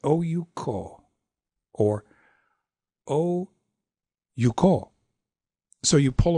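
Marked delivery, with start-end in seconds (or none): 0.0-1.0 "Oh, you" quick and joined, "ko" held long; "Oh, you, ko" as short, short, long; two shorts. Shown as a demonstration. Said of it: "you might have two shorts there". 3.1-4.9 "Oh" held alone, then quick "you" into "ko"; "Oh, you, ko" as long, short, long; short middle syllable. Shown as a demonstration. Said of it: "make that short".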